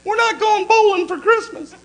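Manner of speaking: high, slow yell from far off; shrill wife yell. Retold or imitated. Wife yelling from the kitchen, high and slow. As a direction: call it out from the kitchen, high and drawn out.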